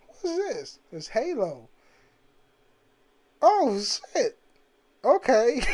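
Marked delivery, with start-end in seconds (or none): 0.1-0.8 high, softer amazed "what's this"; a kid seeing Halo for the first time; surprised. Retold or imitated. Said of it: "I was like". 3.4-5.6 higher and louder "oh shit, okay"; excited. Retold or imitated. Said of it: "reacting to Halo".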